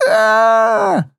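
high panicked shriek; drunk shriek. Acted. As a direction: scream like a terrified drunk. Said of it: "Пьяный визг".

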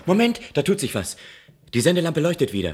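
the lamp is back on; eager excitement; excited relief. Acted. Signal lamp lights again.

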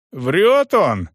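sharp loud bark. A narrator's reading. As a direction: bark it out angrily. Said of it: "рявкнул сержант".

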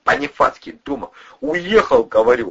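high, pressured confession; loud, high-pitched blurting; cracking under questioning. A narrator's reading. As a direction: spill it all under threat. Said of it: "кололся Любчик".

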